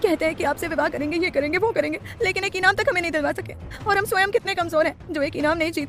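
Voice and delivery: put-on high, tearful girl's voice full of hurt; tearful complaint. A narrator's reading. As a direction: complain through tears, like a wounded schoolgirl. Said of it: "आंसू बहाते हुए वो बोली". (आंसू बहाते हुए शिकायत करती ऊँची आवाज़)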